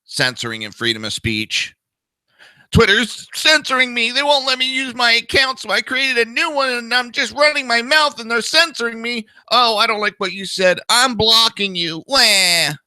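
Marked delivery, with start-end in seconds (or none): 2.7-12.0 high whiny mock of a crybaby. Mocking Never Trumpers who cry about censorship, high and whiny. 12.1-12.7 high mock "wah" crybaby wail; mock baby wail. Mocking crybabies with a high, loud fake "wah". Mock crying after whiny quote.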